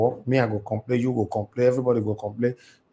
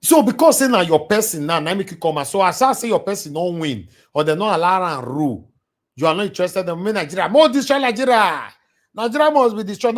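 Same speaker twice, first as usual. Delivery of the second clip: heated shout; 7 semitones higher, 6 dB louder than usual. Real, not acted. Heated rant about hypocrisy and destruction.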